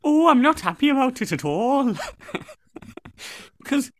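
very high singsong mock Welsh; hammed-up, high-pitched Welsh complaint; Welsh accent. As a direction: plead in a squeaky Welsh lilt. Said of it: "we'll do a Welsh one".

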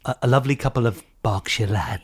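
hushed whisper. Acted. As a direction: whisper it kindly. Whispered conspiracy chat.

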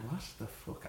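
near-whispered disbelief; retold stunned aside. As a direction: mouth it on breath, as a stunned aside.

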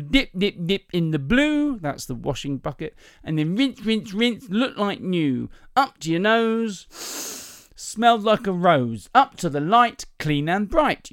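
high, drawn-out sing-song sales pitch; salesman's demo patter. Acted. Salesman demonstrating powder on her blouse, sing-song patter. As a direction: chant it slowly, stretching each line's last word.

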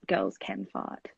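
slow, hushed whispered punchline; whispered aside. Retold or imitated. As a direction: drop it to a breathy whisper. Amused thought about little brother.

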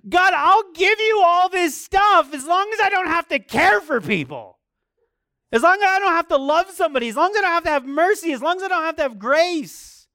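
high, loud, fast, pleading whine of a believer; mock selfish prayer. Believer bargaining with God, mock high pleading. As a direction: pray like someone bargaining their way out of loving people.

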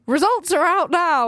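voiced group-chat shout of news; announcing exam results. Housemate announcing results to the group chat, mildly excited. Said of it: "to the house group chat going".

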